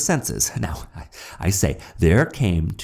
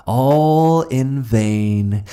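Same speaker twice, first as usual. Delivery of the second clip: slow, loud, higher anguished repeat; anguished cry; 7 semitones higher, 7 dB louder, much slower than usual. Acted. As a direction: stretch it out in loud despair. Narrator's despair swells.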